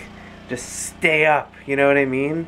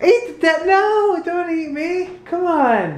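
high mock plea; 13 semitones higher, slower than usual. Dad mock-pleading with toddler.

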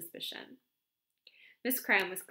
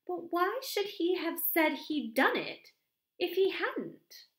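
much higher, slowing at the end; puzzled question; 10 semitones higher, 4 dB louder, slower than usual. A narrator's reading. Miss Cram puzzled by the vicar, questioning high. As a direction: draw out the baffled question.

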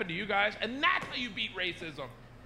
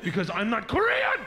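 higher, louder exasperated retort; mock indignant outburst; 5 semitones higher, 5 dB louder than usual. Imitating a stranger mistaken for Korean, indignant outburst. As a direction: let politeness break into an indignant yell.

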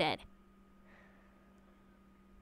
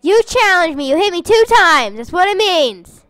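usual speaking voice

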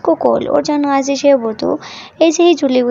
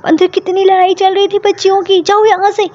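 angry yell; 7 semitones higher than usual. A narrator's reading. Mother yelling at the worker over the puppy, angry. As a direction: tear into the worker, rising higher. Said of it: "वो उसके ऊपर चिल्लाती है".

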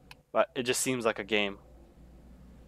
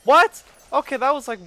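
high loud What; shocked shout; 14 semitones higher, 8 dB louder than usual. Real, not acted. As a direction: yelp in disbelief. Killed by surprise.

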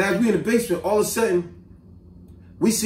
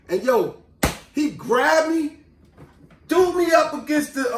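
usual speaking voice

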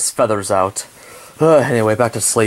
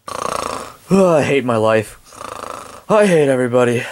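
normal talking voice

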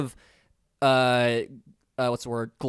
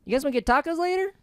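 Aziz Ansari impression; 3 dB louder than usual. Aziz voice offering friends tacos, pitched way up. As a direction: stretch it high like Aziz. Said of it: "if you did John Mulaney and I did Aziz".

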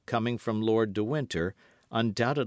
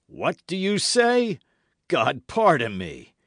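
loud, high, drawn-out outrage; haughty duke, astonished and louder; indignant cry; 10 semitones higher, 7 dB louder, slower than usual. A narrator's reading. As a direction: cry out slowly in affronted disbelief. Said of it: "cried Buckingham".

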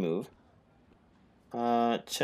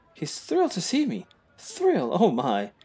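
1 swooning posh voice; 7 semitones higher, 3 dB louder than usual. Acted. Voicing character.

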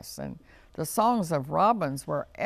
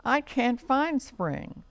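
high, drawn-out little complaint; mildly cross, dragging out the words up high; cross bunny; 8 semitones higher, slower than usual. A narrator's reading. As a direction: complain like a small frustrated bunny. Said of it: "wiggled his ears crossly".

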